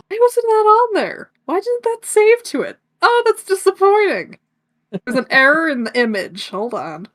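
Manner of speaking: lightly voiced grandma; old woman voice. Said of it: "How come you're doing your old woman voice?".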